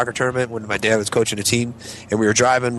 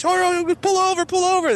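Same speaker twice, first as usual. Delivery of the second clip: very high, urgent pleading; mock panic. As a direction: beg the driver in comic alarm. Panicked riders, bag leaking puke.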